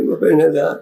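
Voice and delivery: ordinary talk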